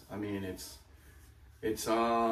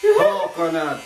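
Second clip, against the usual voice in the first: loud, higher Jamaican coconut; Jamaican accent; 6 semitones higher, 13 dB louder, slower than usual. Pretend. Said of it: "like the Jamaican accent".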